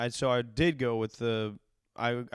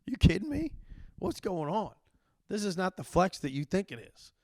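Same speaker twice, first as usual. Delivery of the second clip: incredulous retort; 7 semitones higher than usual. Retold or imitated. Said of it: "I'm like".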